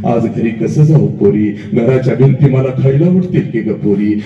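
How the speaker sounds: low acted lament, climbing gradually; mother's lament. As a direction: plead like a grieving mother, building from low pitch. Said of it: "हंबरडा फोडत तुझी आई तुला म्हणते".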